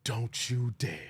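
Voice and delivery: low, slow warning growl; a hint of a stern preacher's threat; lightly voiced Doctor Umar. Streamer slipping into his Doctor Umar impression, low slow warning. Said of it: "I used to do a good Umar".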